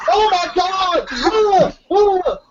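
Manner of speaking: high, squealing mock disgust; mock disgusted characters. Friend retelling horror movie victims squealing in disgust. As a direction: ham up their squeamish gagging. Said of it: "And they're like".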